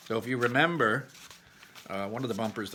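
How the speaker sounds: plain reading voice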